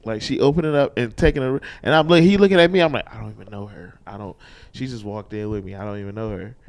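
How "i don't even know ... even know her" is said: under breath. Retold or imitated. Muttering aside at the register.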